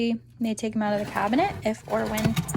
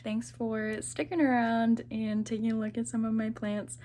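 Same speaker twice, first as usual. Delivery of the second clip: ordinary delivery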